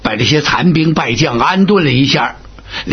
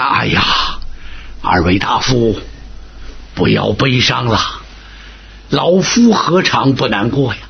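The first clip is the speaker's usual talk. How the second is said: lower, aged statesman soothing the two; lightly voiced old tutor, lower and gentle; old grand tutor; 5 semitones lower than usual. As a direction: speak as an old minister comforting them, low.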